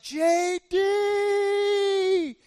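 high, drawn-out holler; long, loud searching shout; yelling for someone. Retold or imitated. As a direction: call out for a lost kid across the mountain. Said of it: "We yell for J D".

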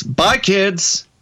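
put-on chirpy goodbye to the kids; cheesy TV host. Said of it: "he would just end it like this".

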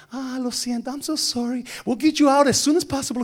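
high, put-on sweet apology; apologetic customer service. Gate agent apologizing to angry passengers, put-on placating voice.

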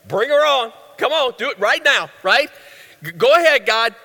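can't wait, hurry God along; eager Adam. Pretend. Adam urging God to create woman right now, eager and impatient. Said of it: "Adam would be like".

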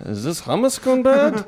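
high put-on Yiddish accent.

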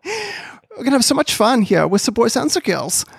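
put-on high, bouncy German accent. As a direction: greet the kids in a cheery German accent. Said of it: "German accent that I do".

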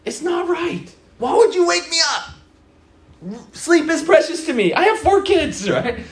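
hammed-up protest at being woken; mock outrage.

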